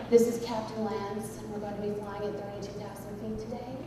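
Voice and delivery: measured pilot patter, hushed and low; airline pilot voice. Acted. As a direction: do a smooth voice-school captain. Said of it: "sound like they've been to voice school".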